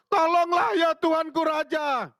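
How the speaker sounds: Tolonglah ya Tuanku Raja shouted high and slow; crying out. Acted. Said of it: "sambil berseru".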